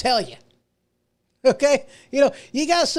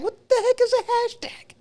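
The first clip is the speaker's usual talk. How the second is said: much higher, hammed-up old-timer confusion; mock bewildered; 14 semitones higher than usual. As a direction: play baffled by newfangled slang. Mock bewilderment about hashtags.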